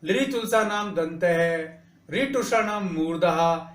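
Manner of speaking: slow, measured recitation of each sutra; reciting the sutras. Shown as a demonstration. Teacher recites the sutras slowly for students. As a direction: spell out every sutra slowly. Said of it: "अब इसके सूत्र भी हैं".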